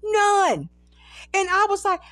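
usual speaking voice

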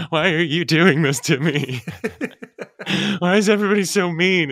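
high, loud, hammed-up wail; mock whining. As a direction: complain like a wounded victim, played for laughs. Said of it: "rather than being like".

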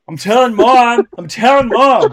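put-on high, loud tattling kid whine; whiny tattling little sibling. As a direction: threaten to tell Mom like a bratty kid.

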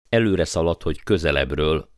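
ordinary delivery, nothing marked

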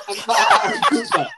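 high squeal of laughter; breaking up mid-name; laughing. Real, not acted. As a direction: cackle the name through laughter. Laughing fit after name mixup.

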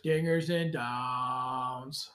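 low, very slow show-title echo; drawn-out echo. Pretend. Co-host echoes the show title in the intro, drawn out low. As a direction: stretch it out long, low and soft.

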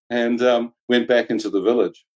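normal talking voice

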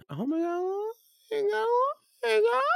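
very high, squeaky mock fretting; mock alarm. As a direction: imitate someone fretting in a tiny high voice. Retold fretting voice outside the bar.

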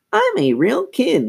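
squeaky puppet talk, lips held still; high-pitched dummy voice. A narrator's reading. Lindsay makes the dummy talk, high-pitched ventriloquist voice. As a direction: speak very high through tight teeth. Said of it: "She was speaking with a high-pitched voice".

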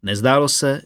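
usual speaking voice

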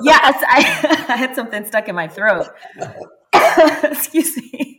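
laughing through an apology; embarrassed laugh while talking. Real, not acted. Laughs off the throat trouble.

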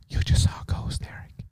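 hushed whisper, as if a ghost speaks; eerie whisper teasing Eric about the ghost; ghostly whisper. Pretend. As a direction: breathe it like a spooky ghost. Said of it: "like I just saw a ghost".

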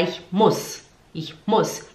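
low, brief U in "muss"; correct short vowel. Shown as a demonstration. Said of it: "Quando tem duas letras juntas".

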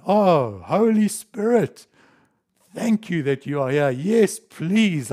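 loud, high, pleading call to a rescuer; a lost walker crying out to the Holy Spirit, loud and pleading; desperate cry for help. Acted.

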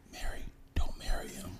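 barely voiced, secretive whisper; hushed warning. Friend warning Mary from afar, hushed warning. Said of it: "watching her from far like".

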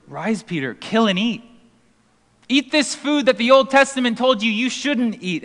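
hint of a booming voice; lightly voiced heavenly command. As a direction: urge him on as the divine voice. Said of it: "the voice says to Peter".

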